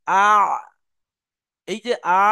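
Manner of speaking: আ squeezed into ayn at mid-throat; ayn articulation demo. Shown as a demonstration. Teacher shows where ayn strikes, demonstrating. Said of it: "আওয়াজটা গিয়ে যেখানে ধাক্কা লাগছে".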